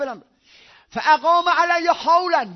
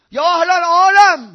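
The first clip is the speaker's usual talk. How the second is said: loud, slow cry to the world; calling out; 4 semitones higher, 10 dB louder than usual. Real, not acted. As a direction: call it out to the whole world. Preacher calls out to the world. (فریاد بلند و کشیده خطاب به جهانیان)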